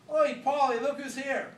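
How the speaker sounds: John spotting Mick in the club, loud gleeful call. Acted. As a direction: hail a mate across the club. John calling to Paul laughing.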